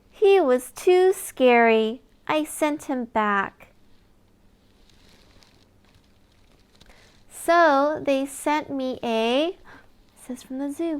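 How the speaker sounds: lively storybook reading with big reveals; reading to children. Acted. Reader sharing a lift-the-flap book with a child, animated. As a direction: read it like bedtime story time for a toddler. Reads picture book aloud.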